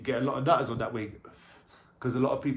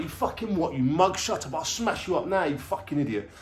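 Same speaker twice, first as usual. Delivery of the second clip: higher, mock-aggressive snarl at the guard; sneering threat, pitched up and pushed; retold angry threat; 5 semitones higher than usual. Young prisoner threatening a prison guard, retold angry threat. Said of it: "I'm like".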